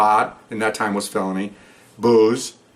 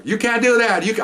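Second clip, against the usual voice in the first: much higher, mock indignant protest; imitating protesting woman; 12 semitones higher than usual. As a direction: squeal her protest up high. Woman protesting while grabbed.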